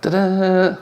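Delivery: high mock-fanfare ta-da; playful reveal. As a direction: present the slide like a magic trick. Revealing the next slide.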